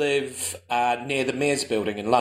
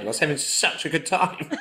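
usual speaking voice

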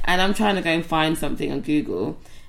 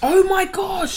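high, drawn-out gasp of surprise; sudden surprise; 14 semitones higher, 4 dB louder, much slower than usual. Real, not acted. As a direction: blurt it out, suddenly remembering. Sudden realisation outburst.

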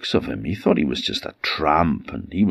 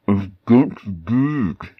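hand over mouth; 3 semitones higher than usual. Acted. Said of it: "a bit muffled 'cause he still had his hand over his mouth".

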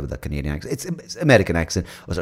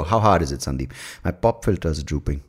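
ordinary talk, nothing marked

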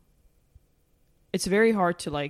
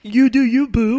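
mocking misread text; 4 semitones higher, 9 dB louder, slower than usual. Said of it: "Maybe you read it as".

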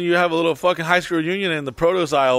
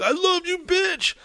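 much higher, squeaky mock declaration of love; mock lovesick guy. Young guy mocking a crazy lovesick declaration, high squeaky voice. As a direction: lampoon a crazy young lover.

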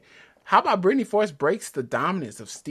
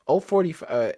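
usual speaking voice

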